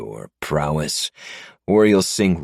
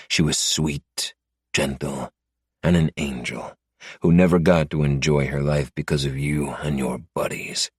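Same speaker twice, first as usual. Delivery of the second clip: ordinary delivery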